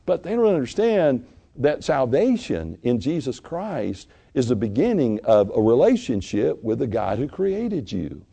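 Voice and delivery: usual speaking voice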